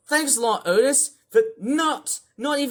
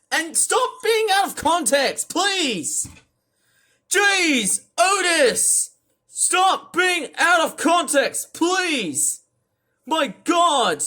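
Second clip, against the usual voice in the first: over-the-top yelling; 8 semitones higher, much slower than usual. Real, not acted. Streamer screaming at Otis over his comments, furious yelling. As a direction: yell at him to stop, fed up. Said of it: "I'm yelling right very much over the top".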